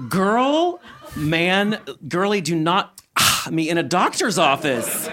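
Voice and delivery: high, loud scolding, then slowing with a laugh; mock outrage. Patient scolding her doctor in mock outrage. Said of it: "I was like".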